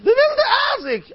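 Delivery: put-on very high child's cry; little boy's voice. Boy telling Tate who hit him, high squealed cry. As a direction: blurt it out as a squealing kid. Boy names the bully to father.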